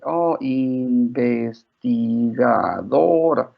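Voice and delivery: INVESTIGADORA very slow, each syllable drawn out and separated. Retold or imitated. Mother and small daughter practising a long word, "investigadora" slowly syllable by syllable. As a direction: sound out "investigadora" slowly, one syllable at a time. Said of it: "syllable by syllable".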